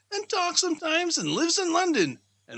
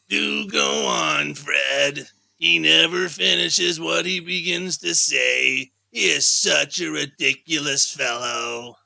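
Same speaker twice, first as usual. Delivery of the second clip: a lower, slower, louder wife's voice; low slow niece; 11 semitones lower, 4 dB louder, slower than usual. Acted. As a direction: drawl it out as the scornful wife.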